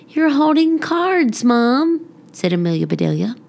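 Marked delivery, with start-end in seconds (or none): 0.1-2.0 put-on child voice. Girl states the obvious.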